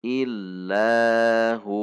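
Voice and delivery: slow recited "Illahu" with the final hu held long; lengthened stop on hu. Shown as a demonstration. Teacher models stopping on the word, slow drawn-out hu. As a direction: stretch the closing "hu" of "Illahu" evenly. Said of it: "dhammah hu menjadi bacaan dua".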